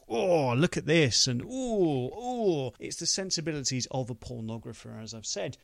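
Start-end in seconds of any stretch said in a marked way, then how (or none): 0.1-2.7 high, loud leering oohs; exaggerated ogling wonder; mock awe. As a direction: gush in mock amazement, drawing out each ooh. Mimics gawping at screen excess.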